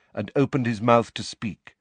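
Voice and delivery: ordinary delivery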